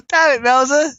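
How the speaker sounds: high, loud, laughing exclamation; blurting it out mid-laugh; real laughter. Real, not acted. Laughing fit over chat message.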